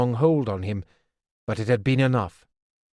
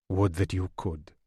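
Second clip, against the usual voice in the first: gently refusing, low and soft; Verity sadly refusing Kettricken, low and quiet; 4 semitones lower, 4 dB quieter than usual. Acted. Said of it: "He shook his head sadly".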